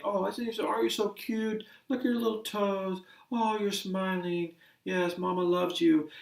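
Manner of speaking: put-on high, soft mother cooing to her baby; sing-song baby talk. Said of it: "they'll talk in a sing-song voice".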